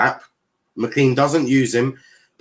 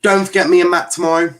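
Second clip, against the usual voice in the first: louder and fast, a plug; loud reminder; 5 semitones higher, 5 dB louder, faster than usual. Real, not acted. Streamer plugs tomorrow's show, loud and fast. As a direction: call it out to the viewers quickly. Promo shout-out to viewers.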